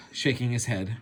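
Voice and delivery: usual speaking voice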